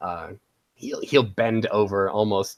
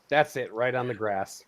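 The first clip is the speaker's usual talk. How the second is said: ordinary talk, nothing marked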